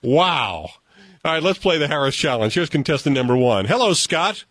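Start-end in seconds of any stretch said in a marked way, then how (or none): none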